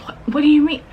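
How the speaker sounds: high, loud baffled question; acted confusion. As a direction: play the crew's sudden confusion. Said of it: "And they're like".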